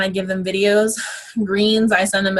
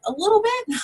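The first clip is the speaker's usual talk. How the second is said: voice taken up; 12 semitones higher than usual. Shown as a demonstration. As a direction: pitch lifted to demonstrate. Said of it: "take your voice up".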